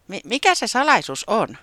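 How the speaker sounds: high, loud stammering eagerness; a touch of impatience; a bit impatient. A narrator's reading. Taputi pressing the ghost, a bit impatient and eager. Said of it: "kysyi vähän malttamattomana".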